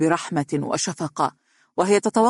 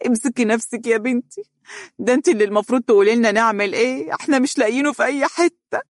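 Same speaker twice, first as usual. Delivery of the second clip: higher, faster pleading; mother soothing, then urgent; consoling mother; 7 semitones higher, faster than usual. A narrator's reading. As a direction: comfort her, then plead. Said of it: "ربتت أم فارس على كتفيها وهي تقول".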